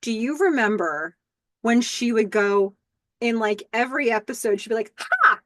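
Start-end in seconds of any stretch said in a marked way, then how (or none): 5.0-5.4 very high, loud battle shout; Xena-style war cry; sharp high yell; warrior cry. Retold or imitated. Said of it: "a warrior cry".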